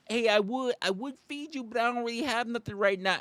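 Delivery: high apologetic voice; mock sheepish feeder. Comic plays a feeder telling the bear no food, high. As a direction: shrug it off high and nervous.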